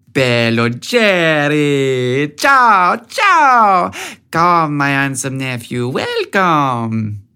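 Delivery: a booming Italian aunt welcoming her nephew; heavy Italian accent. A narrator's reading. Aunt greeting nephew at her door, heavy Italian accent. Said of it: "in her heavy Italian accent".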